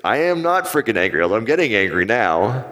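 acting out mock outrage at being called angry; retold angry denial. Insisting to the voice coach he isn't angry, mock indignant. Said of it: "No, no, you're angry".

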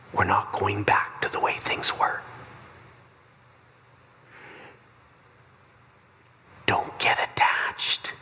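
slow, hushed, tender warning; gentle whisper. Acted. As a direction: whisper it kindly, letting her down. Jesus gently telling Mary.

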